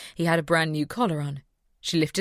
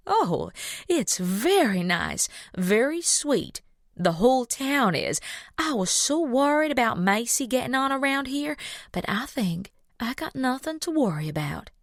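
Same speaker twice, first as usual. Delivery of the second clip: put-on Southern accent; 8 semitones higher than usual. A narrator's reading. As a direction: gush sweetly like a Southern belle. Southern mama speaking.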